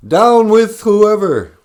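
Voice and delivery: high, loud, drawn-out rallying cry; hammed-up protester yell; mock protest shout. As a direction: holler it like a slogan at a rally. Mock protest chant after viewers.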